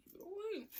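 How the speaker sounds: a silly high echo of keep it away; squeaky high repeat. Pretend. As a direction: pitch it way up and pull back the volume. Repeats the warning twice.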